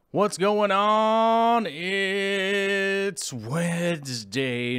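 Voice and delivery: high, slow stretched hype; hyped drawn-out greeting. Acted. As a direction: drag each word out big. Drawn-out hyped stream opener.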